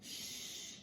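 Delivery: whispered shush; hushing whisper. Acted. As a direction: hiss them into silence. Character hushes the others.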